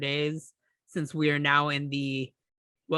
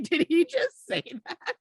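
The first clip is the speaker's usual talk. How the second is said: high laughing disbelief; shocked disbelief; 13 semitones higher than usual. Retold or imitated. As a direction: gasp out the question in shock. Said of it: "I was like".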